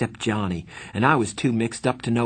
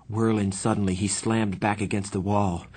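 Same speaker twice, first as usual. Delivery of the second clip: usual speaking voice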